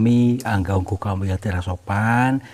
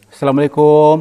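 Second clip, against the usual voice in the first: too loud greeting; 6 semitones higher, 9 dB louder than usual. Real, not acted. Explorer hailing the unseen elder, too loud. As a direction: call the greeting out across a distance. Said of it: "intonasi bicaranya mungkin terlalu kenceng".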